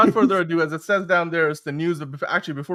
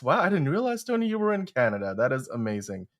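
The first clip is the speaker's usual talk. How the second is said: ordinary talk, nothing marked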